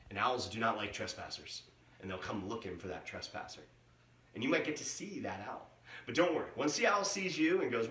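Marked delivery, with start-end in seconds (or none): none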